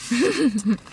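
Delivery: chewing while talking; talking around food; mouth full. Real, not acted. Seen 1: Bit chicken at -7, lips closed chewing through the words.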